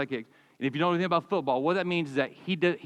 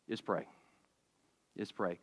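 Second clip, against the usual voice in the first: hushed emphasis; 7 semitones lower, 11 dB quieter than usual. Acted. Pastor stresses prayer comes last.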